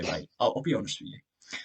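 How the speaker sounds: confessing real voice. Acted. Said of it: "I do put on my voice".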